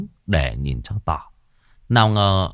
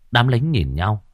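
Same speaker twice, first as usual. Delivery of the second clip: ordinary delivery, nothing marked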